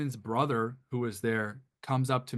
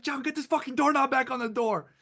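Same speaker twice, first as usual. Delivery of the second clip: furious owner; 12 semitones higher than usual. Retold or imitated. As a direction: imitate the angry owner yelling. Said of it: "she's like".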